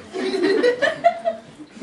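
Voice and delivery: very high burst of laughter; losing it at the joke; squeaky laugh. Real, not acted. Cracks up at deer line.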